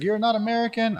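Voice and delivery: hammed-up shocked question, pitched high; mock disbelief. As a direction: imitate a stunned local, high. Mimicking locals' surprised question.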